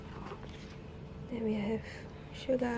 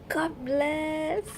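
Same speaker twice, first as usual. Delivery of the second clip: high, drawn-out exclamation of relief; delighted high-pitched blessing; thrilled thanks; 5 semitones higher than usual. Real, not acted. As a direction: squeal it gratefully, pitched up. Rejoicing over a lucky card.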